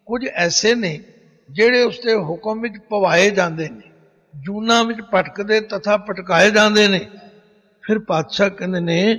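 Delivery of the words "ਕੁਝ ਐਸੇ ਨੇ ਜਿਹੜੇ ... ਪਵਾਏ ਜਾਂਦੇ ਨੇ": usual speaking voice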